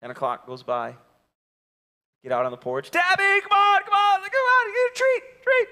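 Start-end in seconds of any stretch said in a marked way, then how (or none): 2.9-5.7 very high coaxing call; squeaky high dog call; really high calling. Retold or imitated. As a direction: go way up to coax the dog. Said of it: "you get really high".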